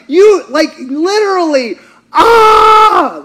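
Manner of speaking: sudden loud cry, rising high. Acted. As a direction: break into a shout. Preacher cries out mid-point.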